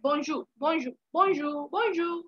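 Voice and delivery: bonjour said with no final r; silent r in bonjour. Shown as a demonstration. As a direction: bonjour slowly repeated, the r silent. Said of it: "तो आर पीछे silent है". (bonjour आखिरी आर बिना बोले)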